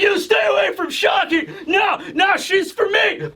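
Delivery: high, hammed-up jealous shouting; mock brawl shout. Pirates fighting over Shaki, high jealous shouts.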